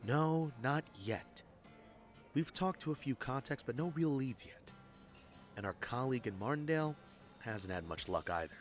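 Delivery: low, hushed voice; discreet update. Acted. Detective updating a client on a stalled case, discreet and hushed. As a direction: keep the bad news low and private.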